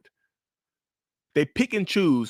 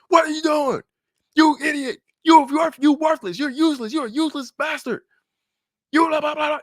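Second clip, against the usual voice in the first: loud, much higher mock tirade; yelling insults; 4 dB louder than usual. Narcissist screaming insults at partner over a small mistake. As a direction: tear into them.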